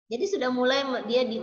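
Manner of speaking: usual speaking voice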